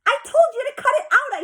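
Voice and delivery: high, loud furious shout; girl shouting at her guests. A narrator's reading. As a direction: shout it over the noise. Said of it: "I shouted".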